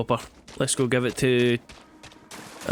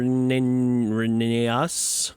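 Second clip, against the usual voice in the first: haltingly drawn-out syllables; sounding out a name; much slower than usual. Real, not acted. Player puzzling over a character's name, sounding it out slowly. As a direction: stretch each syllable, unsure of the name.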